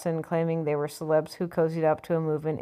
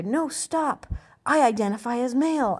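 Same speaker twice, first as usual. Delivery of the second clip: higher-pitched mock plea; mock protest; 7 semitones higher than usual. Imagined woman to her attacker, mock high-pitched protest. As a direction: act out a hammed-up cry to stop.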